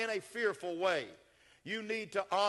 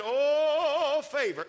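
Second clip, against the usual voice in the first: excited shout; 8 semitones higher, 9 dB louder, much slower than usual. Retold or imitated. Preacher voicing excited man.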